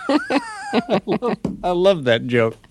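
talking through laughter; real amused laugh running into the words. Real, not acted. Laughs at own punchline.

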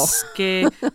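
Masche-, drawn-out Italian syllables; slow pronunciation demo. Shown as a demonstration. Singer coaching the host, slow syllables on "Masche-". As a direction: sound out "Masche-" slowly for a learner. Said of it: "I'm doing it slow".

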